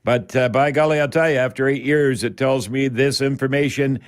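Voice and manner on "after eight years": ordinary delivery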